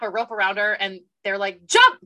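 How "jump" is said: high, sudden retold shout; shouted command. Retelling how they order her to jump, loud high shout. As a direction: yell it out as a sharp command.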